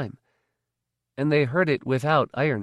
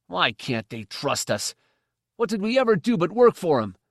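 indignant; 7 semitones higher than usual. A narrator's reading. Slave protesting.

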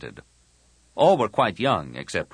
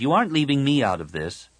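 put-on higher, insistent girl's voice; indignant protest; 7 semitones higher, 4 dB louder, faster than usual. Tahiri insists on joining the talk, indignant. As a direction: dig in and refuse, pitched up.

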